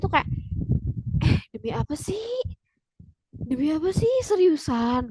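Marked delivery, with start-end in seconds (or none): 1.2-2.4 high, breathy whispered gasp; stunned whisper with a rising end; disbelief. Acted. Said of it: "Kayak nggak nyangka gitu ya". 3.3-5.1 high incredulous question; acted astonishment, rising end; disbelief. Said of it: "Kayak nggak nyangka gitu ya".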